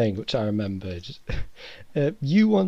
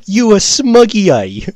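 loud, higher, pointing jab at the latecomer; mocking accusation; 7 semitones higher, 9 dB louder than usual. Elliot accusing the late student in front of class, loud mocking jab. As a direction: call him out loud and high like a gotcha. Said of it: "Elliot instantly points his finger and goes".

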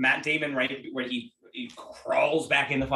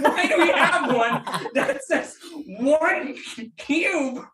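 high, slow, laughing mock bewilderment; laughing disbelief; 6 dB louder, slower than usual. Retelling asking the bar about one cube, laughing high. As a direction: stretch it out, laughing at the absurdity.

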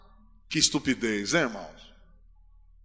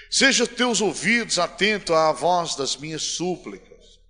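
psalm reading; 3 semitones higher, 6 dB louder, slower than usual. Acted. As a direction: plead the psalm, building to a cry. Reads psalm aloud. (leitura suplicante do salmo, crescendo)

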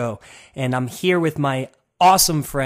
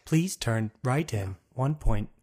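slow, even sat-nav announcement; gps voice; 4 dB quieter than usual. Pretend. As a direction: read it like a car navigation prompt. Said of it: "Can you do the G P S voice?".

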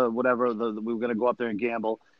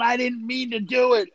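much higher, pleading mock whine; dad's whiny apology; 9 semitones higher than usual. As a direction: play the guilty dad begging forgiveness. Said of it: "He was going".

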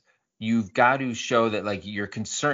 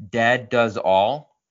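slow drawn-out name; 5 dB louder, much slower than usual. Shown as a demonstration. Host reading out a listener's username, slow and louder. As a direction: read slowly and louder. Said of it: "I'd like to talk to this guy".

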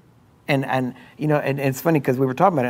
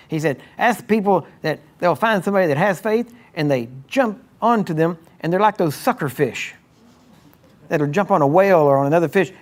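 raised, higher preaching voice pressing the point; 4 semitones higher than usual. Acted. Pastor retelling Doctor Summerall's sermon, full preaching voice. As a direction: lean into it like a sermon climax. Preaching about sucker faith.

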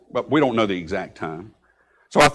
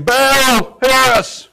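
high, loud drawn-out cry; crying out to Baal; 14 semitones higher, 8 dB louder, much slower than usual. Acted. Prophets calling on their god, loud high cry. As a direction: wail it out, pleading for an answer. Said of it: "saying, 'Baal, hear us!'".

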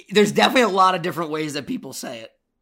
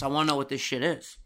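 ordinary talk

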